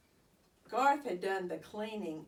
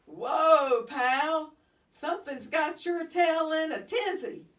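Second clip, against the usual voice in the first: hearty exclamation; 7 semitones higher, 7 dB louder than usual. Acted. Aldo soothing upset hamster.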